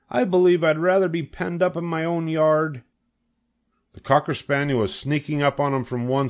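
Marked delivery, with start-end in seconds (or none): 0.1-2.8 high, fretful piglet voice; dismayed young pig. A narrator's reading. Cornered pig regretting his freedom, high and fretful. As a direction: pitch the worry right up.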